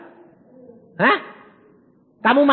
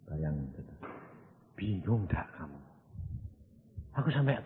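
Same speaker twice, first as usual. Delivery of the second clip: normal talking voice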